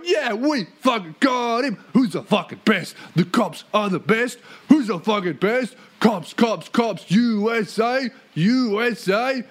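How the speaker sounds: mock celebration. Cops celebrating after the confession, mock triumphant chant. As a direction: chant like hammed-up rowdy fans. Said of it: "having a fucking celebration going".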